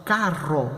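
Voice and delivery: carro with a strongly trilled rr; carro, Spanish rr trilled; rolled rr in carro. Shown as a demonstration. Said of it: "podemos falar a palavra".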